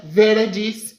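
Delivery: plain reading voice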